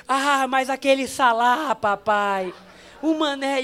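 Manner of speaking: mock tearful kid; whining child.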